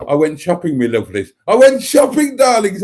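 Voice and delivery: building to a high, loud gush; all excitable. Shown as a demonstration. Telling darlings about a shopping trip, all excitable. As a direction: burst out giddily to adoring fans. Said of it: "I wanna get all excitable".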